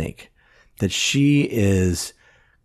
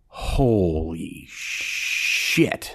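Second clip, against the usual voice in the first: very slow, low, stunned; awed reaction; 5 semitones lower, much slower than usual. Retold or imitated. Moviegoer stunned in the theater, slow awed reaction. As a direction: drag it out in disbelief.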